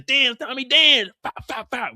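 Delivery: high, loud mocking taunt. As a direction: goad him while you shoot. Vic taunting while shooting.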